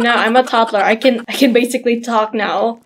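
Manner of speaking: usual speaking voice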